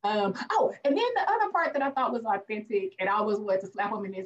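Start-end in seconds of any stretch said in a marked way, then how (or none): none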